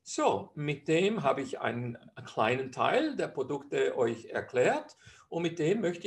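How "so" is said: usual speaking voice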